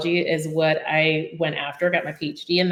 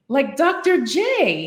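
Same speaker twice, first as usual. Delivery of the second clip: higher, slow, drawn-out Australian boss; Australian accent; 8 semitones higher, 4 dB louder, much slower than usual. Retold or imitated. Boss from Australia coins the nickname, mock Australian accent. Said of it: "I love this accent".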